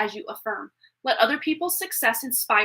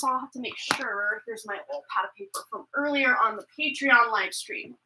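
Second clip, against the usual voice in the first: ordinary talk, nothing marked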